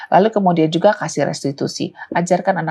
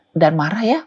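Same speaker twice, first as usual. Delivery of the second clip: usual speaking voice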